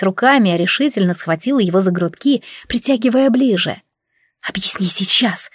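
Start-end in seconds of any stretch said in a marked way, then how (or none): 4.5-5.5 softer, breathy demand; hot breathless plea; breathed hotly. A narrator's reading. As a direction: breathe the demand into his face. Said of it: "выдохнула жарко".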